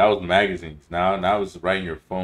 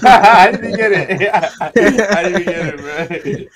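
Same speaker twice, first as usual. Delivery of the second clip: laughing; 13 semitones higher, 13 dB louder, much slower than usual. Real, not acted. Laughing at own confusion.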